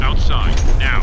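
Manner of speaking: loud barked order to get out; urgent shout. Acted. Time traveller shouts at the girl to get outside. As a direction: shout the command, no time to lose.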